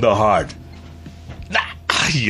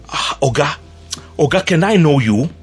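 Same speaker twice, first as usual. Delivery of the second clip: put-on Nigerian-accented guard, pitched up and eager; curious guard asking who the traveller is; security guard voice; 7 semitones higher than usual. Airport security guard asking a stranger, eager Nigerian-accented voice. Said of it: "He says".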